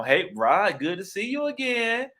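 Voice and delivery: high, slow sweet greeting in a put-on voice; imitating a woman. Woman approaching him with his wife, slow high sugary greeting.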